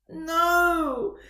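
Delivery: high, loud cry of despair; anguished shout. Acted. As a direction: yell it in dismay. Hears he failed the test.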